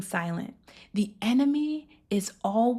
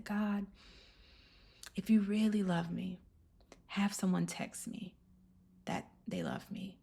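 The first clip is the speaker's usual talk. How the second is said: tearful prayer; 9 dB quieter than usual. Retold or imitated. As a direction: retell a tearful prayer very quietly, with pauses. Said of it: "I'm crying".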